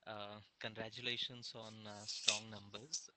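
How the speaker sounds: faint and distant, off-mic; speaking too far from the microphone. Real, not acted. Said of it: "Raghu cannot hear you".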